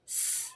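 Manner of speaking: स as a soft "sss" with no vowel; pure s sound. Shown as a demonstration. Teacher showing the s sound for reading, स as pure "sss". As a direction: let स be only a long, quiet stream of air. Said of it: "last में हमने स बोला है".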